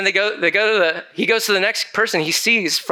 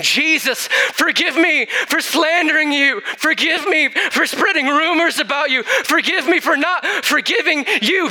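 crying out for forgiveness; 11 semitones higher than usual. Retold or imitated. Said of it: "They were saying".

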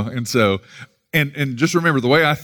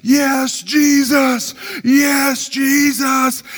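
imitated scream; 13 semitones higher, much slower than usual. Man at the stage drowning out the worship, screaming. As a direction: scream it like a carried-away man at a concert. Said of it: "I think he was screaming".

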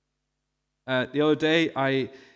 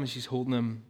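usual speaking voice

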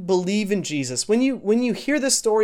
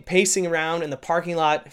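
ordinary talk, nothing marked

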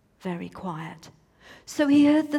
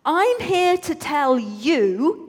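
bigger slow announcement; 7 semitones higher, 7 dB louder, slower than usual. A narrator's reading. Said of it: "No, actually".

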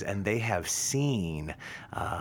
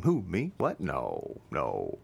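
feigning innocence; 3 semitones lower, slower than usual. Acted. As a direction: play the guilty one denying it, pitch falling on each no. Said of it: "the beta is like".

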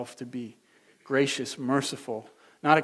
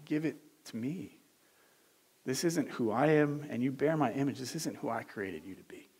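God's tender voice; 6 dB quieter than usual. Acted. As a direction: ask gently, with loving concern. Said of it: "does he say".

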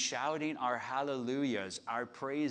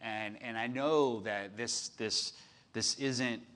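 ordinary talk, nothing marked

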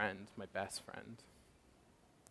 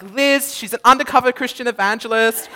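higher and louder indignant complaint; fuming outburst; 12 semitones higher, 12 dB louder than usual. Retold or imitated. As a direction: vent it to your friend, outraged. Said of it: "I was fuming".

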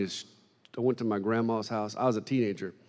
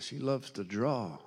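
slow, soft divine instruction; a calm, unhurried command; voice of God; 8 dB quieter than usual. Retold or imitated. The Holy Ghost telling the preacher, slow and soft.

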